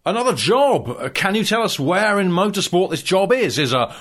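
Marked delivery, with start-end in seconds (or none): 0.0-0.9 surprised. Acted. Interviewer surprised at the news.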